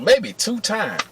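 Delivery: ordinary talk, nothing marked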